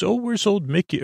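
high-pitched playful greeting; funny high-voiced mock greeting. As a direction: call out high and jokey, imitating someone. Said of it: "he says it in a funny way".

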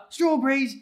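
put-on high-pitched little child's demand; demanding toddler. Dad imitating his daughter demanding food, demanding toddler voice. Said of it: "then she'll be like".

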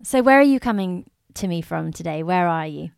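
usual speaking voice

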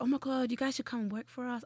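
lightly put-on American recruiter voice; American accent. Hollister staff scouting her friends, mock American accent. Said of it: "I'm doing an American accent".

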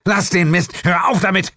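high, loud scolding; annoyed shout. A narrator's reading. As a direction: yell at him to stop fooling around. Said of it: "rief ich".

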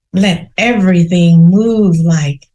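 usual speaking voice

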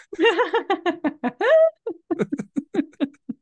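high, loud burst of laughter; big laugh. Real, not acted. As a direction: laugh hard at the punchline. Laughing at own joke.

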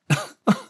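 put-on high-pitched child's coughs; short, acted choking coughs; coughing. A narrator's reading. Boy choking on cigarette smoke, coughing. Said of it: "gagging and coughing".

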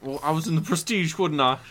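lightly hammed-up Cockney film star; Michael Caine. Playing the actor listing his films, mock-Cockney. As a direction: put on a chatty old English actor. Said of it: "we're just talking about Michael Caine now".

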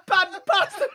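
ordinary talk, nothing marked

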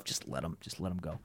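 very low, hushed aside; barely voiced note to self; thought to himself. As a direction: murmur it as a private thought. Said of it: "maybe thought to myself".

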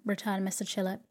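ordinary delivery

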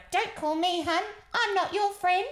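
put-on high, loud snappish old lady voice; imitating a cranky old lady telling her off; snappy old lady. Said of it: "in that exact voice".

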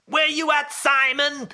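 high-pitched put-on shout; Sean O'Malley. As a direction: call it out in a high, silly accented voice. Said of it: "he wasn't doing his normal voice".

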